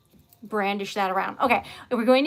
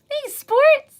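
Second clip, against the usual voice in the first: loud greeting; 11 dB louder than usual. Real, not acted. Greeting a viewer in chat.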